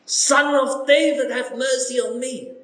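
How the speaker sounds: a high, pleading cry for mercy; blind man crying out. Acted. Bartimaeus crying out to Jesus for mercy, high and pleading. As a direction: beg him as if your life depends on it. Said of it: "someone cried out".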